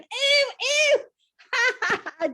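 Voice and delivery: high, loud squeal of "ew"; screaming in disgust. A narrator's reading. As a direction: recoil with a shrieked, grossed-out cry. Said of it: "Chloe jumped up screaming".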